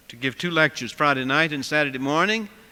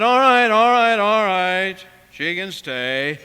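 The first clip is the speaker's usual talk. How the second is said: grudging surrender; 7 dB louder, slower than usual. Retold or imitated. Cook gives in to the crowd.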